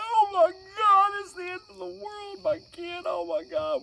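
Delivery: high, hammed-up wail of alarm; tearful panic. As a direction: panic like the world is ending. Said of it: "we're over there in tears".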